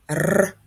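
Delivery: "Р" as a long rolled trill; sustained trilled Р; isolated consonant sound. Shown as a demonstration. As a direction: roll the Р on its own, with no letter name. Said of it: "Далі ми чуємо звук".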